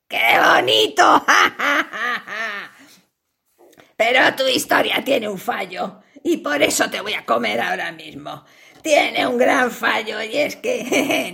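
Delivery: low spider voice with cackling laughs; gloating spider. Acted. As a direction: laugh cruelly at the trapped fly. Spider mocks fly, laughing.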